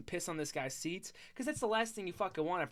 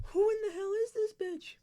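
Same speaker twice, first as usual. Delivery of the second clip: much higher, incredulous mock voice; dad's disbelief. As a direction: play a stunned old man asking who she is. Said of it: "looks at her like".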